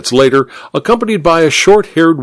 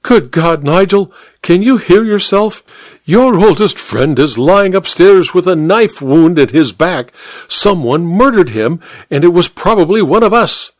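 high-pitched, outraged duchess; higher voice, acted dismay; appalled cry; 7 semitones higher than usual. A narrator's reading. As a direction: berate him in shocked disbelief. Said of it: "cried the Duchess".

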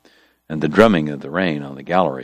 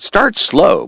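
urging; 4 dB louder than usual. Acted. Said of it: "Morg urged".

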